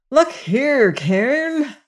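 a sudden high exasperated cry; cried out. A narrator's reading. Syme cries out at Cairn, fed up with his chatter, exasperated high cry. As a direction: flare up, voice jumping high. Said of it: "cried Syme".

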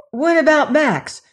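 anxious question. Acted. Lilas asks the policeman about Max, alarmed. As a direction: blurt it out in alarm.

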